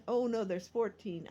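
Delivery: ordinary talk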